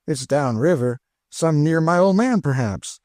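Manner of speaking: hopeful questioning lilt; half asking the missus. A narrator's reading. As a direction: let it end as a timid question. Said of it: "in the tone of a question".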